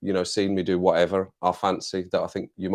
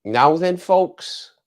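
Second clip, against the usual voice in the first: high, loud Scouse greeting; Scouse accent; 7 semitones higher, 5 dB louder, much slower than usual. Shown as a demonstration. Streamer shows a viewer his Scouse greeting, put-on Scouse accent. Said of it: "Let me get into the Scouse accent".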